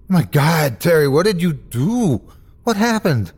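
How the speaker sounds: shocked at a friend's injury; alarmed. Acted. Narrator to injured Terry, alarmed.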